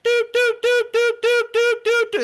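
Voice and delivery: high, loud, steady string of bleeps; imitated censor bleep. Shown as a demonstration. Man showing how cussing gets bleeped out, high monotone beeps. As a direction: imitate a censor tone, high-pitched and evenly repeated. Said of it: "it's bleeded out like".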